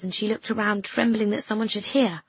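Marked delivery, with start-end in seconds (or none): none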